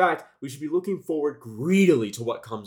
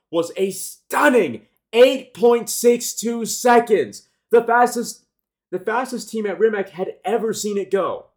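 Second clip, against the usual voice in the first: excited; 8 semitones higher, 8 dB louder, slower than usual. Real, not acted. Revealing the record quarter mile.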